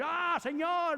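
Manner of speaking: a high mock cry to the Lord; mock shouted prayer. Pastor mocking prayer yelling, high mock cry. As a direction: ham up an over-the-top prayer shout. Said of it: "No hay que gritar".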